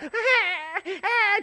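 high imitated baby cry in the back seat; mock baby wail, high. Baby crying in the back seat, high mock wail. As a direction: wail it like a fussing infant. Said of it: "in the back seat, I got".

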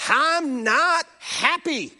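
high, slow whiny pout; mock a cranky toddler; toddler tantrum voice. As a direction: toddler protesting a taken toy, whiny. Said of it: "And they let you know".

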